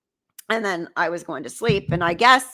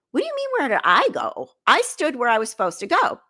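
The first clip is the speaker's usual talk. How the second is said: higher-pitched retold indignation; incredulous, pitched-up protest; indignant retort; 6 semitones higher than usual. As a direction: replay your offended comeback. Retold argument in hotel lobby.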